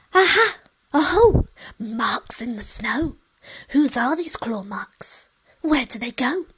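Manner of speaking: a little monster thrilled by the clue; excited child. Acted. Child finds claw marks.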